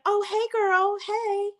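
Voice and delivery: high sugary fake-friendly hello; butter her up with a high sing-song hi; fake sweet greeting. Belinda acting sweet to her friend, high fake-friendly greeting. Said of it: "Belinda's like".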